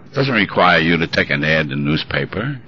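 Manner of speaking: ordinary talk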